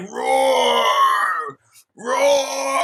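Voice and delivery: loud laughing mock roar. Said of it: "add a roar soundbite".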